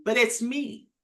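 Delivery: normal talking voice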